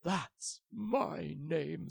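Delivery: slow, awed recognition; dragon voice. A narrator's reading. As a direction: breathe it in wonder. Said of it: "said the dragon".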